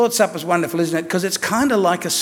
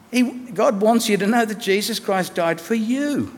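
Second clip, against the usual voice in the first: ordinary talk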